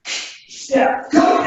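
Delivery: short barked dojo commands, rising high; sharp martial arts commands. Acted. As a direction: call each move crisply like a sensei. Instructor calling kata commands.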